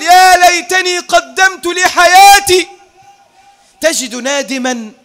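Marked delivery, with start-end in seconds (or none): 0.0-2.7 slow, high, loud cry; regretful shout. Acted. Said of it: "تجد نادما يصرخ ويقول".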